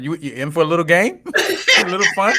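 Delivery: laughing. Retold or imitated. Mocking the cop's offer, laughing.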